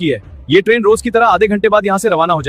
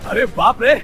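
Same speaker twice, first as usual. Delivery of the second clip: normal talking voice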